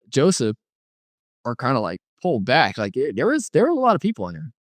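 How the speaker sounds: ordinary delivery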